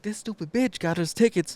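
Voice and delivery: high-pitched acted outrage; angry complaint. Wife complains to husband about tickets, angry.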